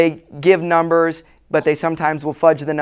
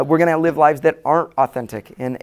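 ordinary talk, nothing marked

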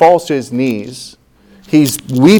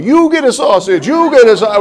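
higher, showy game-show host giveaway; Oprah giveaway; 9 semitones higher than usual. Retold or imitated. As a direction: hand out prizes like Oprah. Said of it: "We're like Oprah".

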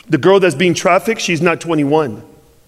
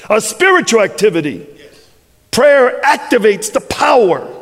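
full-force preaching; 6 semitones higher than usual. Acted. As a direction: preach it up to a climax.

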